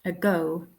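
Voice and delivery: "Ago" with a weak schwa start and stressed "go"; throwaway first vowel in "Ago", weight on the end; second-syllable stress. Shown as a demonstration. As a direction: lean on the "go" in "Ago". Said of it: "the second syllable, the go, is stressed".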